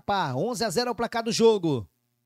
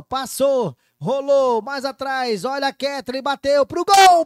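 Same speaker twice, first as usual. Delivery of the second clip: louder and louder, higher; excited play-by-play; 4 semitones higher, 9 dB louder than usual. Real, not acted. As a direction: build up to the shot. Attack building to a shot.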